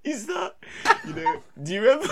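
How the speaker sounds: high wheezing laughter; laughing hard. Real, not acted. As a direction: crack up mid-sentence, squeaking. Laughing fit mid story.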